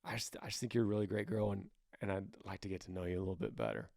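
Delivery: quick shy mumble of the compliment; shy confession. Shown as a demonstration. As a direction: say it low and fast like a nervous admission. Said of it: "a little embarrassing saying it out loud".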